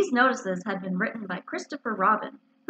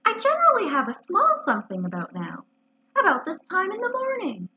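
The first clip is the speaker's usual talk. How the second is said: lilting, higher-pitched hinting; dreamy hint at a treat; 8 semitones higher than usual. Acted. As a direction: muse aloud, pitch floating upward. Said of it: "he went on dreamily".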